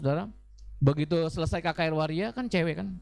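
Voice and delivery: usual speaking voice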